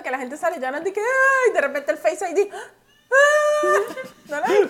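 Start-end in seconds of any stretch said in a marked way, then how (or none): 1.0-1.5 sudden high, loud mock wail; mock crying wail. Imitating a TikToker crying on camera, hammed-up high wail. 2.5-3.9 sudden high, loud mock wail; mock crying wail. Imitating a TikToker crying on camera, hammed-up high wail.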